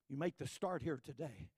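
ordinary talk

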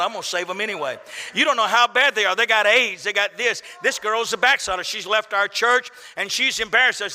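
ordinary talk

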